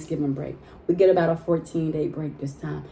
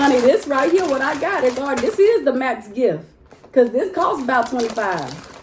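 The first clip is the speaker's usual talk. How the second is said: excited; 7 semitones higher, 4 dB louder than usual. Real, not acted. Showing off the gift box.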